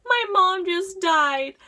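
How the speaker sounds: high, loud, slow mock wail; imitated crying. Teacher telling of her mom's death, imitated wail. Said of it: "like, crying and on the phone".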